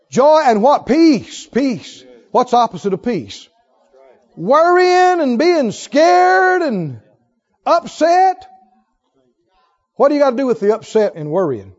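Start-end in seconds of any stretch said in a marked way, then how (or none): 4.4-8.5 high and slow, drawn out; stretched high preaching; exaggerated emphasis. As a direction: paint the worry big.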